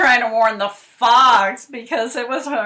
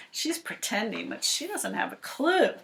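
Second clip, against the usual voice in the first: ordinary delivery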